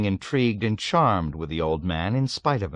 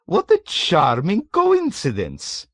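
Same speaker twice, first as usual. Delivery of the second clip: higher, louder, slow delighted question; light up at the coincidence; 10 semitones higher, 6 dB louder, slower than usual. A narrator's reading. Old man asks Nately, delighted.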